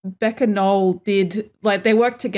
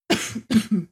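a single rough cough; chesty cough. Real, not acted. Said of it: "bless you for that cough".

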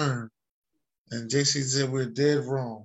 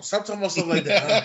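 laughing protest; 4 semitones higher, 4 dB louder, faster than usual. Real, not acted.

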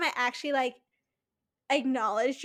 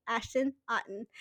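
ordinary talk, nothing marked

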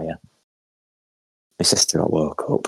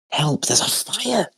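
retold scream; 12 semitones higher than usual. Mum raising the alarm about a fire, imitated scream. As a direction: imitate a panicked cry for help. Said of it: "absolutely screaming".

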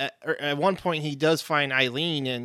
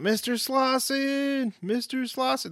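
calling out high; 11 semitones higher, 3 dB quieter, slower than usual. Retold or imitated. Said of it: "she's yelling out".

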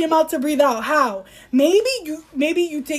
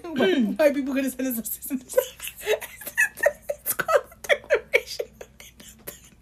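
real wheezing laughter through the words; helpless laughter; 4 dB quieter than usual. Real, not acted. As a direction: laugh so hard the voice squeaks away. Helpless laughing fit over own joke.

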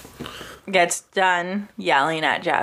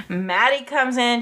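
ordinary delivery

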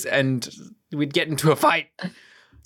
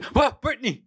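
mock startled cry; 9 semitones higher than usual. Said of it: "see Britney's".

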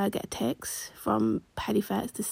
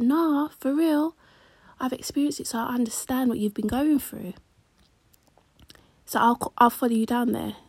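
mock sympathetic friend; 4 semitones higher, 4 dB louder than usual. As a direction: lay on fake sympathy thick. Fraudster quoted sympathising, overdone.

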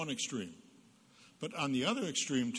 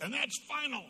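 angry dad yell; 12 semitones higher than usual. Retold or imitated. Father yells at fighting kids behind the door, mock angry yell. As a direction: burst in like a fed-up father.

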